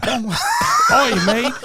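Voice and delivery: very high, squealed mock Cockney "Oi, mate"; a squeaky, joking London accent. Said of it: "I can't do that accent".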